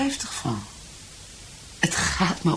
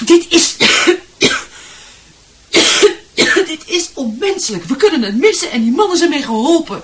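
upset pleading; 10 semitones higher, 6 dB louder than usual. Acted. Wife pleads, upset, coughing.

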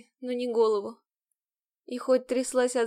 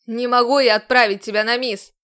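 much louder outburst; snapping shout; 10 dB louder than usual. A narrator's reading. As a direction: lose his temper. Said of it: "Снова сорвался он".

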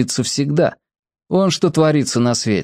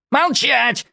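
shouted order; 13 semitones higher than usual. A narrator's reading. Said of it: "заорал сержант Бабчиков".

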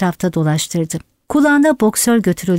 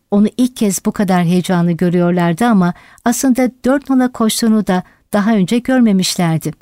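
usual speaking voice

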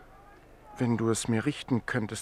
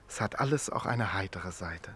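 soft whispered afterthought; a hushed, wry whisper; whispered aside; 5 semitones lower, 6 dB quieter than usual. Acted. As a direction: breathe it as a private joke. Wry aside about being eaten.

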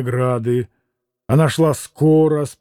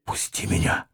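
hushed breathy whisper, much softer; 11 semitones lower, 10 dB quieter than usual. A narrator's reading. Katya begs Mitya to let her go, in a whisper. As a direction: whisper it, barely sounding. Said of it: "прошептала она".

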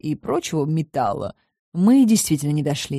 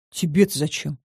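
ordinary delivery

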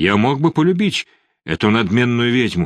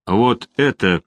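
без выражения; slower than usual. Acted. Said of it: "без всякого выражения сказал Пьетро".

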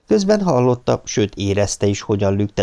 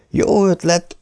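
helyeslő; 11 semitones higher, slower than usual. A narrator's reading. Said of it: "mondta helyeslően Harry".

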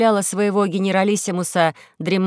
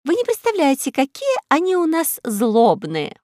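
gossipy housekeeper; 7 semitones higher than usual. Acted. Увара tells Карло how vicious they are, high and emphatic. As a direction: exclaim high, stretching the words. Увара voiced complaining of tyrants.

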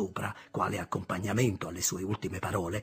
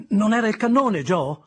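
put-on a boy's urgent question, raised and louder; curious boy's question, much higher; eager question; 11 semitones higher, 9 dB louder than usual. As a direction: ask Joe eagerly. Pip asks Joe eagerly.

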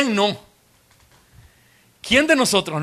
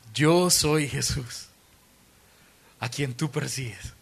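voice of Jesus; 4 semitones lower, 4 dB quieter, slower than usual. Acted. Jesus answers Saul.

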